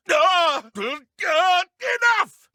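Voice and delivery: loud high yells of pain. Acted. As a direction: cry out as the blows land. Enemy cries out under attack.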